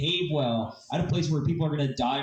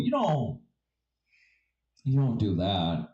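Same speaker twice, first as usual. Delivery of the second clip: ordinary talk, nothing marked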